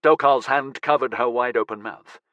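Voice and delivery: plain reading voice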